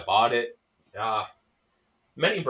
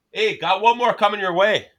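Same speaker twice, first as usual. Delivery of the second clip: put-on high, loud Canadian-accented call; hammed-up Canadian barroom call; tipsy Canadian accent; 8 semitones higher, 6 dB louder than usual. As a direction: sing it out in a Canadian lilt. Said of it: "what all my American buddies think I talk like".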